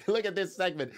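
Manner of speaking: ordinary delivery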